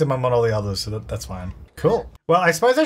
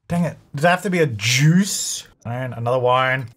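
usual speaking voice

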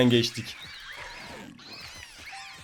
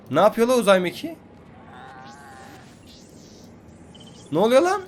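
high, louder amazed exclamations; astonished; 4 semitones higher, 4 dB louder than usual. Real, not acted. Gamer spotting a spaceship in the sky, loud astonishment. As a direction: gasp out at the sight above.

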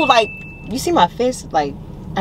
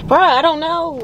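exasperated; 12 semitones higher, 5 dB louder, slower than usual. Real, not acted. Exasperated at being pushed.

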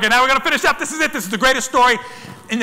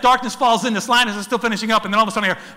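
ordinary delivery

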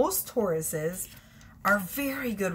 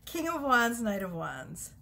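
normal talking voice

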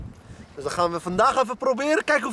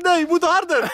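insistent quote; 10 semitones higher, 5 dB louder than usual. Retold or imitated. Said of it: "Hij zegt".

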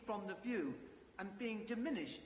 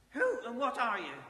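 put-on loud, sharp old man's demand; 4 semitones higher, 9 dB louder than usual. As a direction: bark the question out loud. Scrooge demands of the ghost.

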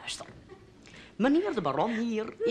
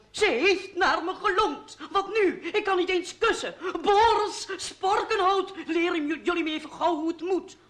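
mock flustered baron, pitched higher; imitating the baron; 6 semitones higher than usual. Sporkenhout retelling the baron's panic to Rut, higher mock fluster. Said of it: "vliegt meneer de Baron hier binnen met de woorden".

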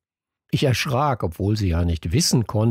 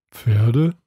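a touch of disbelief, louder rising Pferde; incredulous; 4 dB louder than usual. A narrator's reading. Kalu baffled by the horse idea, incredulous. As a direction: question it in mild disbelief. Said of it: "fragte Kalu ungläubig".

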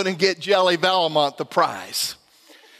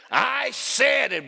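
slow, high, strained mock demand; lightly hammed-up possessed insistence; imitated gurgly yell; 7 semitones higher, slower than usual. Wife pulling husband down to her face, mock gurgly yell. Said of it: "in my face yelled".

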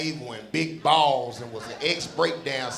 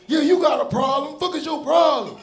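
put-on high-pitched, confrontational thirteen-year-old boy; little boy voice; 9 semitones higher than usual. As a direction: imitate a cocky little boy picking a fight. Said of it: "a little thirteen-year-old little boy voice".

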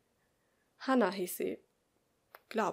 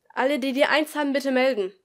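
louder, higher classroom call; teacher calling out; 4 semitones higher, 10 dB louder than usual. Acted. Teacher asks the class to raise hands, calling out. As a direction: address the whole class.

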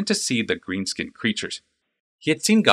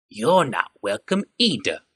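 slow snarling goblin; a goblin throwing the words back; 3 semitones higher, slower than usual. A narrator's reading. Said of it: "said the goblin with a snarl".

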